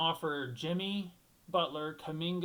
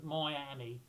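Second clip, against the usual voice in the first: Miami with a mock Irish "moy" opening; Irish accent; 2 semitones lower than usual. Shown as a demonstration. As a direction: put on an Irish lilt for Miami, said "Moy-annie". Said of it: "Irish accent".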